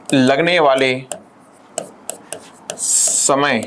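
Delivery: word by word with long pauses, slow; slow, spaced-out dictation for note-taking. Shown as a demonstration. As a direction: dictate it so students can write. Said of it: "तो सुनिएगा".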